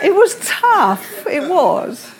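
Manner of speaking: laughing through the words; a rueful laugh as she says it. Real, not acted. Preacher laughing at her own hard times.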